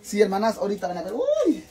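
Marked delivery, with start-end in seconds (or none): 1.1-1.6 excited yelp. Real, not acted. Tortilla reveal excitement.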